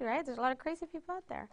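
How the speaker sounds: normal talking voice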